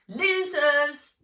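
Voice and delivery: high, loud jeer; taunting shout. A narrator's reading. Sister racing away from the others, shouting back. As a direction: shout it back at them, drawn out. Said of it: "she shouted back over her shoulder".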